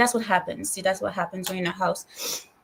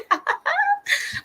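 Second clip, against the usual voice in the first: laugh. Real, not acted. Laughing about her charger.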